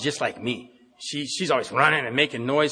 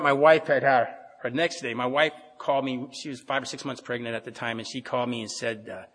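ordinary delivery

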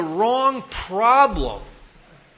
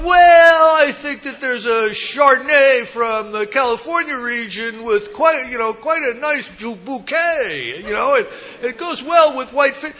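louder, higher mock snooty clerk; wine expert clerk; 5 semitones higher, 6 dB louder than usual. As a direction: ham up a know-it-all wine clerk. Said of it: "the guy's going".

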